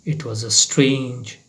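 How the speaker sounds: ordinary delivery, nothing marked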